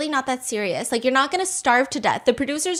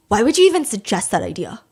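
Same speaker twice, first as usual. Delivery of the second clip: dismissive; 3 semitones lower, 4 dB louder than usual. Retold or imitated. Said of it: "almost like the attitude of".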